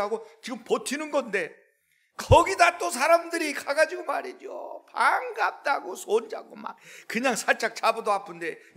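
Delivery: loud, high preaching climax; fiery preaching. Acted. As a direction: build the sermon to a shout. Preaching at full force.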